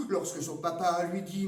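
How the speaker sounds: plain reading voice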